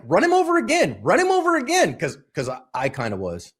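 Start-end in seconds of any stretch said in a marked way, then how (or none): 0.1-2.0 egging on. Retold or imitated. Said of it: "were you like".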